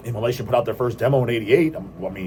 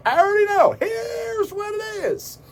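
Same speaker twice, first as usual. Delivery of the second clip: high drawn-out shout; slower than usual. Pretend. Vlogger to viewers, announcing his theory with a high, slow shout. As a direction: leap up high and stretch it into a shout.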